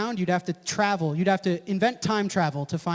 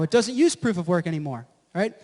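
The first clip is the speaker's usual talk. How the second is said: ordinary delivery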